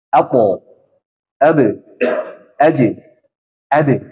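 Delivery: apo, abe, aje, ade with a crisp bounced stop; qalqalah bounce. Shown as a demonstration. Teacher drilling the correct qalqalah. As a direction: bounce each stop cleanly. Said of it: "dipantulkan ako".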